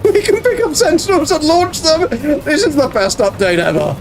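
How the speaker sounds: high, thrilled exclamation; excited. Real, not acted. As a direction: squeal it out with delight. Thrilled game discovery.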